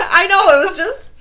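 loud, high, laughing words; real laughter. Real, not acted. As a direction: talk helplessly through the laughs. Still cracking up.

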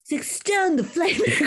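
a laughing, mock Australian twang; hammed-up Aussie, half giggling; high laughing try at an Australian accent; Australian accent attempt. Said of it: "which I will try to replicate for you".

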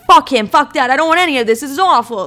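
retold angry outburst. Client reacting to psychic's twin flame news, worked-up protest. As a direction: reject it all in a worked-up burst. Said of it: "that is literally how I responded to her".

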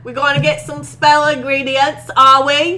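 loud, hammed-up Cockney English accent; mock a chirpy "governor" English accent. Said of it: "Billy is somehow fucking English".

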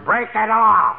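yelled from the stands; mock holler. Husband mimicking a wrestling fan hollering, mock yell.

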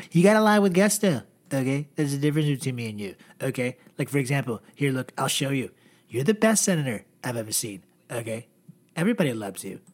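a lightly done, boastful Trump; Donald Trump voice. Retold or imitated. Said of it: "Then Donald's like".

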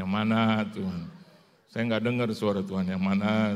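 loud retold plea to God; seruan doa. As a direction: call out to God for an answer, loudly. (memohon kepada Tuhan dengan suara lantang)

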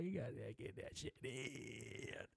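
stretched, hushed mock advice. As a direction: drag it out slowly, almost under the breath. Repeats the advice, drawn out.

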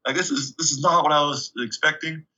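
high-pitched, exasperated questioning, not really mad; grilling. Retold or imitated. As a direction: act out a coach grilling a player. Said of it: "just grilling me".

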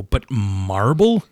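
slow, stretched disbelief; incredulous drawn-out question. Real, not acted. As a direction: drag out the word in disbelief. Disbelief at carpeted marble.

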